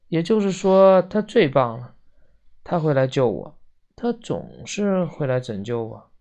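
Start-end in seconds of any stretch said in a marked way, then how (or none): none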